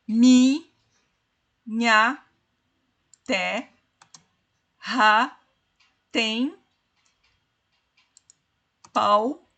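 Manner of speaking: one syllable at a time, long pauses; slow classroom syllable division; dividindo sílabas. Shown as a demonstration. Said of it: "Vamos dividir as sílabas". (sílabas isoladas, bem pausadas)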